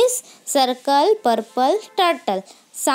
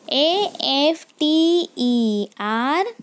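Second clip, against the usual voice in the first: each letter spaced out slowly; slow dictation of letters; slow spelling; much slower than usual. Shown as a demonstration. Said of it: "teacher spells word for class".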